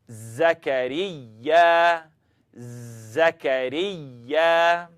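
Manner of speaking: Zakariya drawn out very slowly, z buzzing; very slow demo. Shown as a demonstration. Teacher says Zakariya slowly for students to copy. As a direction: spell out Zakariya syllable by syllable. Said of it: "Let's try one together".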